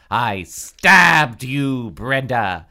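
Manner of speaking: loud, slow, anguished accusation; hammed-up, drawn-out shouting; crying out. A narrator's reading. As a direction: wail it at your victim in disbelief. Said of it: "Dina cried".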